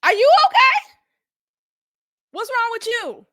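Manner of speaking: high, incredulous check-up on someone; judgmental, high-pitched disbelief; judging look in words. Retold or imitated. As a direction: ask it high, like they've lost their mind. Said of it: "looks at her like".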